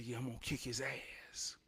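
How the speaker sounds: whispered, very soft threat; whispered boast. Retold or imitated. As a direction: hiss the promise under your breath. Quietly answering classmates.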